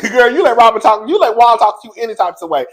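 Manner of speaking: normal talking voice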